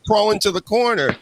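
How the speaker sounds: high, hammed-up fake fright; mock panic. Host mocking a wrestler who pretends to fear Becky, with hammed-up high fright. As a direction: play the scared heel sarcastically.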